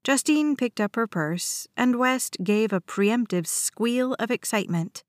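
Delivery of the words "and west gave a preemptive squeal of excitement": ordinary delivery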